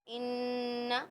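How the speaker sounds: इन्ना with the न्न held through the nose; nasal ghunna. Shown as a demonstration. Teacher demonstrating ghunna to students, nasal hum. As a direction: hum the doubled noon. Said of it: "नाक से हम निकालते हैं".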